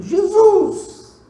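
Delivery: high, loud shout of the name; crying out. Retold or imitated. Boy screams for Jesus as his little brother rolls downhill, loud high shout. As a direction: call "Jesus!" with full force.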